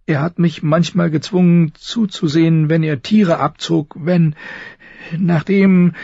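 nervous, high, halting voice; high and hesitant, feeling for each word; Samwell Tarly. Acted. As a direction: stammer timidly to a superior. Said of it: "Sams nervöse, hohe Stimme".

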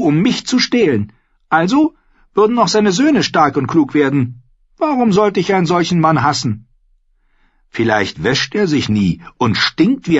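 put-on high, bold young wildling woman; spirited young woman, teasing; Ygritte voice. Narrator voicing Ygritte.

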